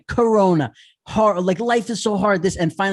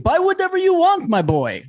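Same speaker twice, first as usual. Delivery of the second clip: showy dad; 11 semitones higher, 3 dB louder than usual. Retold or imitated. Dad voiced spoiling his kid.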